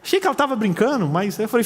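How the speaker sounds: usual speaking voice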